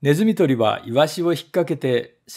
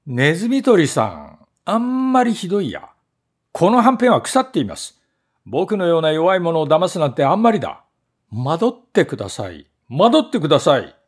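higher, loud indignant yelling; angry shout; 3 semitones higher, 4 dB louder than usual. Acted. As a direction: scream at the trap about the rotten food. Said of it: "怒って叫びました".

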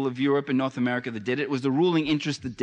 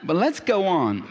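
normal talking voice

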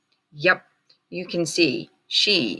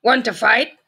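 grouchy ladybug voice; 6 semitones higher, 6 dB louder than usual. A narrator's reading. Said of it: "said the grouchy ladybug".

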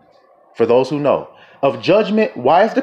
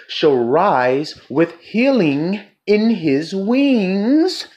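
very slow, stretched-out recitation; lingering preacher's declamation; scripture proclamation; 4 semitones higher, much slower than usual. Acted. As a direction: draw each word out to the end.

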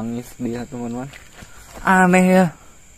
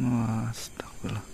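a hushed, stunned prayer; awed whisper; 6 dB quieter than usual. Real, not acted. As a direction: whisper it in shocked awe. Ghost hunter sees a kuntilanak.

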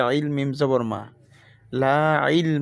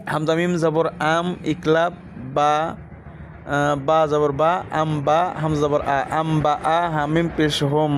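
ordinary talk, nothing marked

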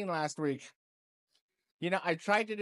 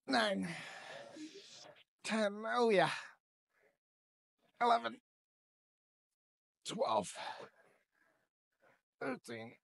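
strained rep count, pitched up; doing side laterals; 5 semitones higher than usual. Real, not acted. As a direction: count reps while lifting hard. Time for some dumbbell side laterals.

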